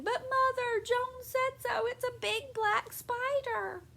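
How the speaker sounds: put-on high, slow squealing child; girl insists to her mother, squealing high.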